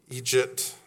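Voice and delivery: "Egypt" said as Irish eejit, EE-jit; "Egypt" repeated quietly with long ee, eejit; Irish pronunciation. Shown as a demonstration. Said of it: "is actually pronounced Egypt".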